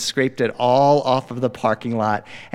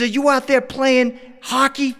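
aunt voice; 10 semitones higher than usual. Retold or imitated. Aunt questions the kids.

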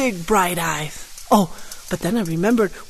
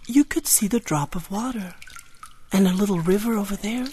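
ordinary delivery

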